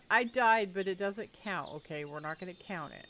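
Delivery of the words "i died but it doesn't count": loud laughing protest. Real, not acted. Laughing admission of dying.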